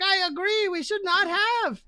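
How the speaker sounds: very high gargoyle voice; high, hammed-up regretful gargoyle; agreeing gargoyle. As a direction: agree in a squeaky-high creature voice. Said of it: "Gargoyle five is like".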